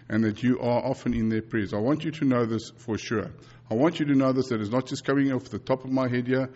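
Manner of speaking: usual speaking voice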